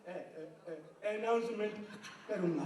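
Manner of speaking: low, very drawn-out stutter; stammering friend. Retold or imitated. Stammering friend claiming he'll announce, halting. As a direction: drag the ए, ए, ए then push out. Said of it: "Hakla".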